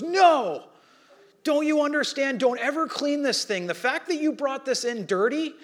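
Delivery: retold frantic expert shouting her down; excited outburst. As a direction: stop her before she ruins it. Expert urgently stops her cleaning.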